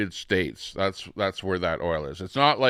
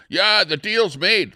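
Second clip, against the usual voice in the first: imitating Trump; 10 semitones higher, 5 dB louder than usual. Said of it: "whenever Trump says".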